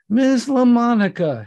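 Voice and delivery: gushing welcome to the movie star; eager exclaim. A narrator's reading. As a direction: call out her name like a fawning host. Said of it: "Hector was in front to exclaim".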